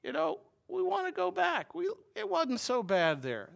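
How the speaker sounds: high mocking whine about going back; whiny grumble. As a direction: pitch it up into a complaining whine. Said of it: "And so he's like".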